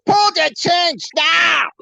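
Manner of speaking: put-on high, shouted German-accented command; shouted order. German stranger demanding the chain be pulled, shouting. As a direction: explode like a German officer.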